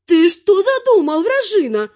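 high, loud granny outrage; indignant granny. A narrator's reading. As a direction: scold sharply in a high character voice. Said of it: "возмутилась бабуля".

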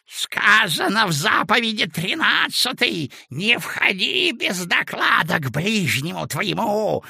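put-on a high, old-man mumbling intonation; old Lustrinovy preaching a commandment; toothless mumble. A narrator's reading. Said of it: "прошамкал Люстриновый".

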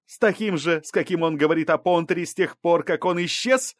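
high, scornful challenge; scoffing. A narrator's reading. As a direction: fire back a sarcastic question. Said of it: "усмехнулась Балбай".

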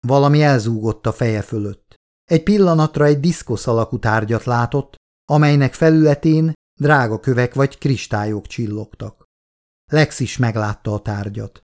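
ordinary delivery

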